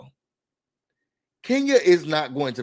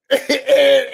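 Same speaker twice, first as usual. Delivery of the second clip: burst of laughter; 6 semitones higher, 5 dB louder than usual. Real, not acted. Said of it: "laugh heard".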